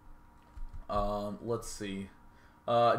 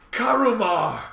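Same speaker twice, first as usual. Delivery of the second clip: loud, high booming echo call; big hall echo; 10 semitones higher, 10 dB louder, slower than usual. Pretend. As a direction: boom it out into a cave. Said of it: "sound all ultra authoritative".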